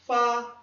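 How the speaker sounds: "fa" high, level and loud; first tone. Shown as a demonstration. Teacher reading out the first tone, "fa" high and level. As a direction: hold "fa" firmly on one high note. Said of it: "Primeiro tom".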